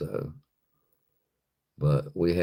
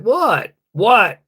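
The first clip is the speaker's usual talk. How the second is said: high, loud, baffled double what; shouting what at a staring dog; exasperated what; 10 dB louder than usual. Retold or imitated. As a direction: throw it back at him, high and loud. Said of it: "I'm like".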